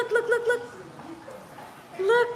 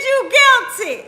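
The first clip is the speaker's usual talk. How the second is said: high, loud pronouncement of guilt; mock shouted verdict; 6 semitones higher, 6 dB louder than usual. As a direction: bark out the verdict, laughing.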